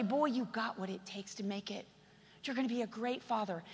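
tender encouragement. Acted. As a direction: reassure him gently like a father. Voicing encouraging father.

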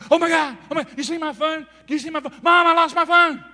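high, loud mock panic; frantic phone-loser wailing for mom; hammed-up panicked yelling; breathless panic. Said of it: "like they can't breathe".

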